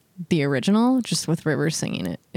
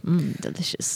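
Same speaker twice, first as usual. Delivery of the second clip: tasty yum mmm; 3 semitones lower, 6 dB quieter than usual. Retold or imitated. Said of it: "I don't know why I'm like".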